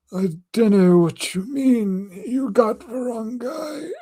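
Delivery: slow, high, sobbing denial; crying high voice. Acted. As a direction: beg with a teary voice. Beaten Brook denying.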